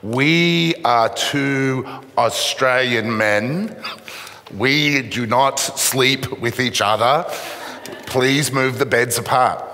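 very slow, deliberate over-enunciation; loud and slow. Retold or imitated. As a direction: spell it out slowly to a foreigner. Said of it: "started speaking very loudly and slowly".

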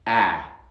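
"A" as in cat, loud; firm "A" midway between ah and eh; in-between vowel. Shown as a demonstration. Teacher shows the English vowel between two, firm cat-vowel "A". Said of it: "algo entre esos dos sonidos".

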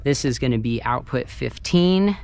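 ordinary talk

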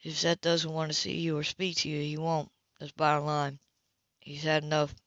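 understated stern man; lightly voiced Philip. Philip voiced in a read novel.

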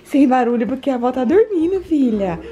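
ordinary talk

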